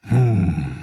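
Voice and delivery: ordinary delivery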